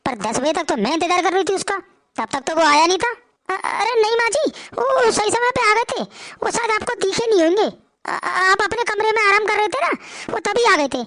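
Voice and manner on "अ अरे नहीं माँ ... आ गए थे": higher, hurried pleading voice; anxious wife. Acted. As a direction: insist nervously, hiding the truth. Wife lies to mother-in-law. (ऊँची, घबराई आवाज़ में बहू)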